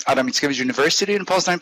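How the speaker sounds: normal talking voice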